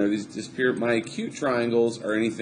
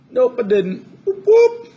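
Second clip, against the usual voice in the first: laughing, squealing whoop of triumph, much higher and louder; excited whoop; 13 semitones higher, 8 dB louder than usual. Real, not acted. Teacher cheering that she made no mistake, excited squeal. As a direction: cheer yourself on, giddy and squeaky.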